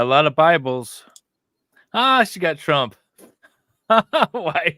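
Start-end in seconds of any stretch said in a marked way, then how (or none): none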